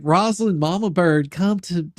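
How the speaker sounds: high, loud mock pleading call to Rosalind; mock creepy fan voice. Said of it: "they'll be like".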